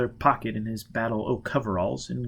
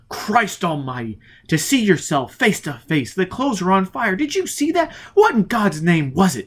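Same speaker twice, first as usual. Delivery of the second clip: higher and louder, rising alarm; shaken outburst; 8 semitones higher, 5 dB louder than usual. Acted. Foyle demanding answers from Robin, higher and alarmed. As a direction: horror spilling out.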